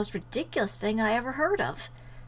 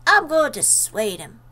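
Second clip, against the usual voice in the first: raised, louder declaration; emphatic resolve; 4 semitones higher, 8 dB louder than usual. Acted. Narrator resolves to talk John out of quitting, emphatic resolve. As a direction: announce it forcefully. Acted firm declaration in story.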